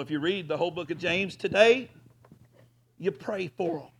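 ordinary delivery

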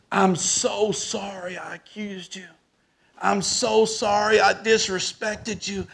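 acting out a grown man crying as he apologizes; bawling apology. Car buyer saying sorry for accusing him, imitated bawling. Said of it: "He is bawling".

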